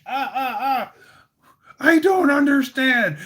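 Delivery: loud high whining cry; high pained yelp. Retold or imitated. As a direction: yelp it while being punched. Joker getting punched.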